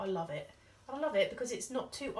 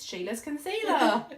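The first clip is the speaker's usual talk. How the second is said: playful emphasis; 5 semitones higher, 9 dB louder, slower than usual. Pretend. Jokey emphasis on Sheila.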